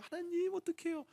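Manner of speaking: high, soft little whine to God; acting out a small, fretful prayer; helpless pleading. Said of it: "이러고 있는 제 모습이".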